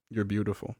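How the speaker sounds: very soft, low retold compliment; hushed, understated tenderness; quiet admiration. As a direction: murmur it as the wounded hero would. Wounded hero gazes at his attacker.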